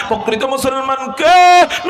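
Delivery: loud, slow challenge to the hall; rousing question. Real, not acted. Preacher challenging the congregation, loud and slow.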